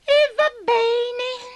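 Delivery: high, slow grudging spider voice; spider giving in. A narrator's reading. Abigail agreeing to help, high and slow. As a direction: give in slowly in a high little voice.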